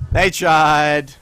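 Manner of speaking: loud, dramatic mock tribute; hammed-up eulogy for the losers; They tried, proclaimed loud and mocking; mock solemn. Mocking the enemy's failed fight.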